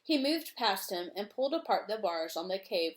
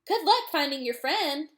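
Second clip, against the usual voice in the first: calling out; 6 semitones higher, 5 dB louder than usual. Acted. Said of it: "Kiora called out".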